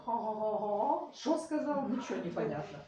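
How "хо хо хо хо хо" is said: closed-mouth mock mumble of Southern speech; imitating a Southerner talking through closed lips; mumbled southern drawl. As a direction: mumble it without opening the mouth. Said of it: "не открывая рот".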